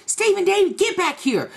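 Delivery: high mock call to a runaway child; hollering after the boy, imitated; high imitated grandma calling the boy back; mock scolding call. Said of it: "she was like".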